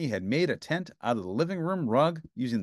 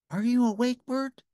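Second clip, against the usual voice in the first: Ernie whispering; 12 semitones higher than usual. A narrator's reading. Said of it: "he whispered".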